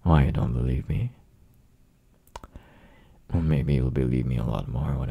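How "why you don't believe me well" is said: ordinary delivery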